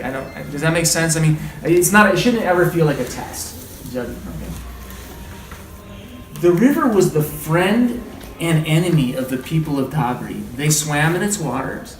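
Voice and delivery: usual speaking voice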